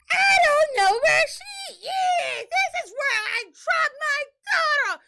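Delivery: high, loud, drawn-out wail; screaming on and on. Retold or imitated. Wife mimicking husband still going on in the truck, screaming. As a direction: scream it like a hysterical parent. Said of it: "he's still going on".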